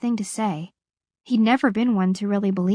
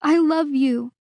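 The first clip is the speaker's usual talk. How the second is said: tearful; 6 semitones higher, slower than usual. A narrator's reading. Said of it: "she said in between sobs".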